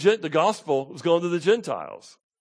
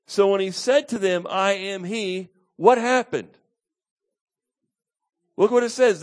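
emphatic preaching; 7 semitones higher, 7 dB louder than usual. Acted. Preacher telling the congregation what happened next, emphatic preaching.

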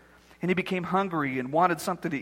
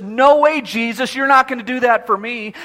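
Peter protesting; 4 semitones higher, 7 dB louder than usual. Acted. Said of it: "Peter says".

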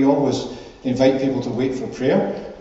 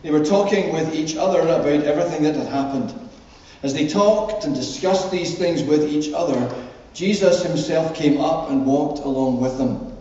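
measured public Bible reading, lifted and deliberate; scripture reading; 4 semitones higher, 4 dB louder than usual. Acted. Minister reading the Emmaus Road story to the congregation, scripture reading. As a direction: read it out clearly to a congregation, phrase by phrase. Reads the Bible passage aloud.

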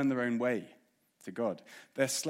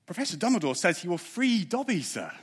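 high, louder squeaky elf voice; excited house elf telling his friends; 7 semitones higher, 5 dB louder than usual. Acted. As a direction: pitch it up like an eager little elf. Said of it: "I'm gonna read you an extract".

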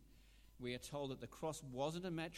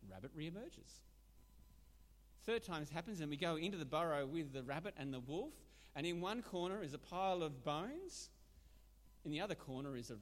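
ordinary delivery

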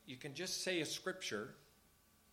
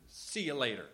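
preachy, higher and louder scolding; lecturing Pharisee; 3 semitones higher, 6 dB louder than usual. Retold or imitated. Preacher mimicking Pharisees lecturing the people, loud preachy scold. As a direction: wag a finger, then dismiss them. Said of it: "they would just tell them the law".

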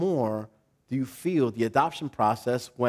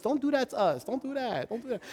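softer, higher pleading; pleading parents; 9 semitones higher, 8 dB quieter than usual. Retold or imitated. Embarrassed parents pleading with their misbehaving kids, soft. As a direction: beg quietly, fading away. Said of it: "shaming parents".

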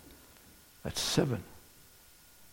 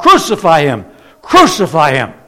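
loud, forceful acted chant; retold crowd yell; 9 dB louder than usual. Mob yelling at Pilate for crucifixion, loud acted yell. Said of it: "they yell out".

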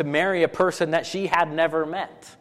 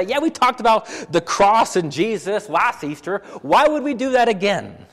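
mock dismissive; 5 semitones higher, 4 dB louder than usual. Mock dismissive churchgoer quote.

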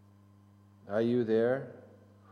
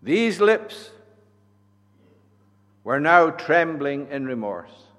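preaching; 2 semitones higher, 12 dB louder than usual. Acted. Preaching at full force.

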